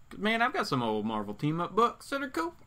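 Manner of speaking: talking through hiccups; hiccuping between words. Real, not acted. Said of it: "got the hiccups".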